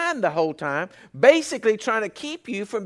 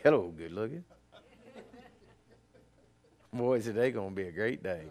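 self-admiring greeting; 9 semitones lower, 8 dB quieter than usual. Pretend. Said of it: "you say".

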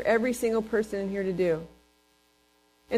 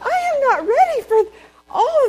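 overwhelmed; 7 dB louder than usual. Real, not acted. Overwhelmed by the casino chaos.

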